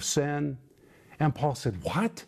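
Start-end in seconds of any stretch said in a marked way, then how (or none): 1.8-2.2 a sharp, high What of disbelief; mild acted shock; startled exclamation. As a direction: snap it up like you can't believe it. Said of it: "it is an exclamation".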